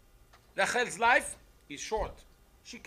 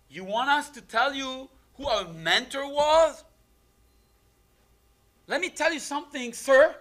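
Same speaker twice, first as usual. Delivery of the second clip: defiant retort; 6 semitones higher, 6 dB louder than usual. Acted. Leah to Yaakov, throwing his own trick back: defiant retort. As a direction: turn the tables on him with pointed slowness.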